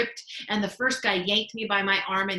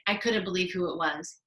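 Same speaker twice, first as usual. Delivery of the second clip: plain reading voice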